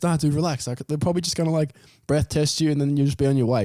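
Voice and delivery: high, lightly voiced relaxed mate; mate reassuring. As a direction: brush the panic off, mate.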